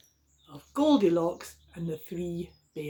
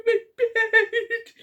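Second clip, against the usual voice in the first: very high squeaky cub, louder; Baby Bear; 6 dB louder than usual. Acted. Baby Bear complaining about his bed, high and squeaky. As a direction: whine it like a small cub. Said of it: "And Baby Bear said".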